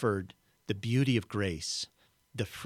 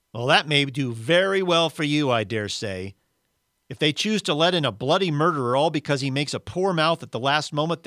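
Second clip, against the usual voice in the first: loud, higher-pitched self-righteous protest; aggrieved character insisting on his worth; indignant ghost; 5 semitones higher, 7 dB louder than usual. Acted. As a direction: protest your rights with wounded pride. Ghost scorns the spirit.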